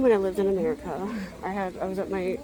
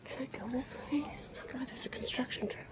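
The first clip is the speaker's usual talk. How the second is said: soft unvoiced whisper; 3 semitones higher, 11 dB quieter than usual. Real, not acted. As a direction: whisper so nobody nearby hears. Quiet filming at the zoo.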